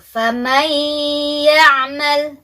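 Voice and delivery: FAMAN YA'MAL recited with N merged into a long humming Y; correct idgham recitation. Shown as a demonstration. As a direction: demonstrate فَمَنْ يَعْمَلْ as "famayy-ya'mal", hummed two beats. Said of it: "itu bacaan yang betul".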